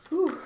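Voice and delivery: a loud, high release of breath; emotional whew. Real, not acted. Reader shaking off emotion before continuing, loud exhale. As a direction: blow out the feeling and reset. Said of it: "Excuse me for getting emotional".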